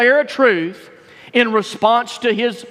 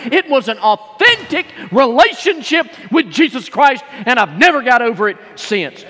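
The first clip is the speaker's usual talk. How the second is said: higher, driving revival-preacher delivery; fired-up preaching; 5 semitones higher, 5 dB louder than usual. Acted. Preacher testifying of the day he got saved, fired-up preaching. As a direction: preach it hard, punching key words. Preaches his conversion at full force.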